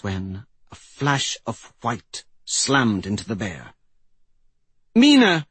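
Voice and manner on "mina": high, loud cry of shock; shocked shout. A narrator's reading. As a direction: shout the name out, high and sudden. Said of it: "Suri shouted in shock".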